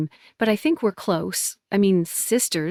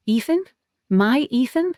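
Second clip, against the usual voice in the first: high, stunned rising questions; can't believe what she hears; shocked disbelief; 6 semitones higher than usual. Acted. Disbelief at sister's news.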